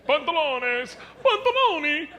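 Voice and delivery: high, exaggerated Spanish-to-Italian accent; mock Italian-Spanish accent. Comedian showing two similar languages, in hammed-up Italian-Spanish accents. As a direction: ham up the Italian and Spanish words. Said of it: "like Italian and Spanish".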